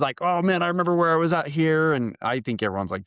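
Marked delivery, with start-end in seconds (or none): 0.2-1.9 higher-pitched excited reminiscing; all excited. Retold or imitated. Runner recalling the route to his companions, all excited. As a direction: imitate yourself gushing on the trail. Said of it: "I was all excited".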